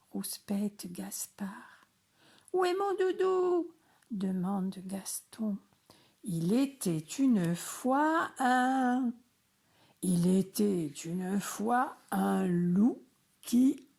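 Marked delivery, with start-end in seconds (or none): 2.5-3.7 put-on much higher, faster little-kid voice; small child voice. Little frog Gaston asks for his cuddly toy in a high child voice. 6.2-13.9 louder, slower booming wolf-tale voice; big storyteller voice. Acted. Dad starts the wolf story in a loud big voice. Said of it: "c'est bien toi cette grosse voix".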